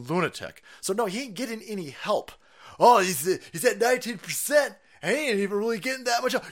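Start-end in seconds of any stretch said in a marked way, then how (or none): 2.8-6.4 mocking quote. Mocking the left's excuse.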